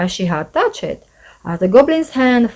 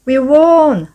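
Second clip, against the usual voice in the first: higher, louder victory cheer; 6 semitones higher, 6 dB louder than usual. A narrator's reading. Kirsty cheering the boat race win, excited. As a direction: shout the win. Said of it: "cheered Kirsty".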